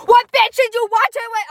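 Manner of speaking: high, loud mock accusation; mock jealous outburst. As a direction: blurt it out like a play-jealous girlfriend. Jokingly acting the jealous girlfriend.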